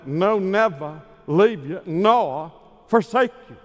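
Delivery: stack the "never"s like a promise; emphatic five negatives. Shown as a demonstration. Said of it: "You could actually say it this way".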